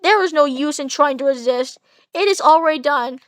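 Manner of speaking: normal talking voice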